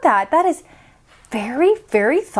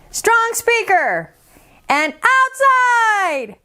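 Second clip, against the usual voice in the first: rising higher and slower; building louder; 8 semitones higher, much slower than usual. Shown as a demonstration. Teacher demonstrating growing voice volumes. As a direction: build each level up. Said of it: "five voice volumes".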